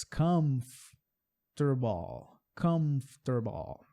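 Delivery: comfortable slowed, three syllables kuhmf-ter-bl; slow model word. Shown as a demonstration. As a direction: break comfortable into three beats. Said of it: "três sílabas".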